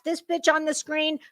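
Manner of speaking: ordinary delivery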